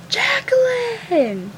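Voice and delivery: high, drawn-out holler of a name; mock yell. Mom yelling at her daughter after the scream, high mock yell. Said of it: "yelling at her".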